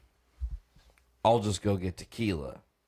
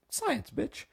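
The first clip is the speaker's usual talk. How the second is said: Breaking Bad quote; 5 dB quieter than usual. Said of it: "they thought".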